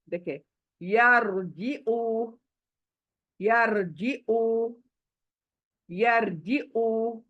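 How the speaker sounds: यारजिउ drawn out, र said thick; heavy ra, slow. Shown as a demonstration. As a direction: demonstrate यारजिउ slowly with full र. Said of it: "मोटी होगी". (यारजिउ धीरे, र मोटा)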